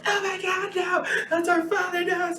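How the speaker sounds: high, shouting daughter, desperate; panicked pleading cry. Retold or imitated. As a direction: beg to save her father. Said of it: "She's like".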